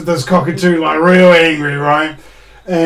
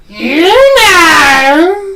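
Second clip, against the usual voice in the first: high, louder knowing parrot; sly cockatoo; 12 semitones higher, 6 dB louder than usual. Retold or imitated. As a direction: parrot lets the insult hang. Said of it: "he goes".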